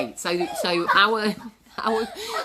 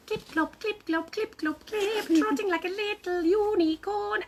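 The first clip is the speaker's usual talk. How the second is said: high, sing-song clip-clop; dainty trotting; 11 semitones higher than usual. Pretend. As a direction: tease in a high little unicorn voice. Teasing mimic of a trotting unicorn.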